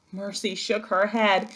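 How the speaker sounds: plain reading voice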